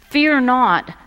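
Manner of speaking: "Fear not" proclaimed high and loud; preacher booming "Fear not"; emphatic. Acted. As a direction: ring out "Fear not" from the pulpit. Repeating angel's words emphatically.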